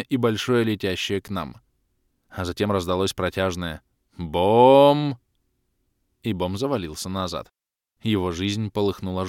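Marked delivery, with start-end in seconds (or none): 4.2-5.2 loud long gong-like bom; ringing bom. Acted. Narrator imitating the blow, ringing bom. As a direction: ring it like a bell.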